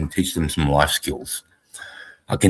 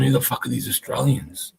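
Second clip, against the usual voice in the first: higher voice imitating bewildered Europeans; mock disbelief; 7 semitones higher, 4 dB quieter than usual. Storyteller voicing Europeans baffled by Australian soldiers, mock disbelief. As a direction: play the baffled onlookers, pitched up. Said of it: "the Europeans are going".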